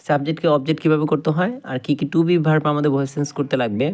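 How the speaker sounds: ordinary talk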